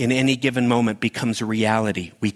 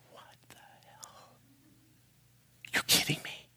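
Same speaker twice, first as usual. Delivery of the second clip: hushed, breathless disbelief; shocked whisper at the replay; stunned whisper; 14 dB quieter than usual. Retold or imitated. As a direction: whisper it like you can't believe your eyes. Stunned reaction to camera footage.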